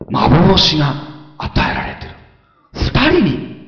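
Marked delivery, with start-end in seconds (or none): none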